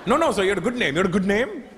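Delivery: mock Indian call centre agent, insistent; Indian accent. Agent insists on his question in an Indian accent.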